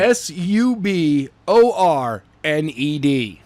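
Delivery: spelled out letter by letter. Shown as a demonstration.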